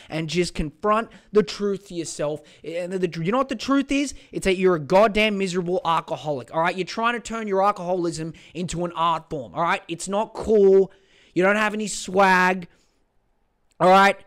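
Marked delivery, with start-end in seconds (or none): 3.2-14.2 higher, louder harangue at the customer; ranting. Real, not acted. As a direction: lay into him, building.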